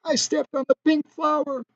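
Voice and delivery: high, soft tearful whimper; crying. A narrator's reading. Beautiful woman confessing to the two friends, soft tearful whimper. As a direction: whimper it through tears. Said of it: "Crying, the beautiful woman".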